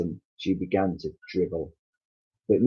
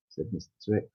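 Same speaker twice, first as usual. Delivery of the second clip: plain reading voice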